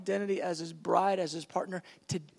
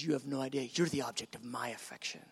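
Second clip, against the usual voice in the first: much lower, hushed reply; tender voice of God; 8 semitones lower, 8 dB quieter, faster than usual. Acted. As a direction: sink deep and soft to return the affection. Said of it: "He goes".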